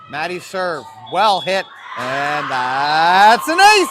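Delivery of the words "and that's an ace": slow, swelling shout climbing to the last word; excited ace call. Real, not acted. Commentator hailing Maddie's serve, excited shout. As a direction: stretch it out, then burst loud.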